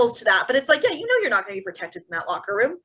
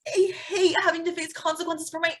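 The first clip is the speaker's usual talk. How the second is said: higher, hammed-up spoiled whine; mock self-pity; 7 semitones higher than usual. Co-host voices the owner's burden, higher mock whine. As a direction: play the poor suffering rich man.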